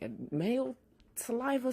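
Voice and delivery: ordinary delivery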